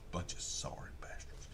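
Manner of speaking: a muttered whispered insult; whispered aside. Real, not acted. Storyteller whispering an insult at the shoe makers. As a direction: whisper it under your breath.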